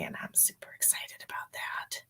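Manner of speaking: a secret, giddy whisper; hushed, private thrill; whispered excitement. Real, not acted. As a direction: whisper it quietly.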